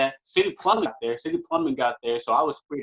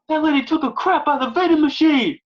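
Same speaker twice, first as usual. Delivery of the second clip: high, loud, put-on yelled announcement; coworker shouting the news; 13 semitones higher, 8 dB louder than usual. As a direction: holler it so the whole station hears. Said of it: "He's like".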